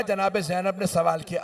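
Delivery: ordinary talk, nothing marked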